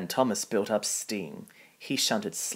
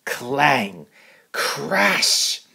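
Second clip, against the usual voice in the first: loud, rising mock clang and crash; crash noises; 5 semitones higher, 6 dB louder than usual. A narrator's reading. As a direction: bang it out like falling iron. Scrap iron crashes down.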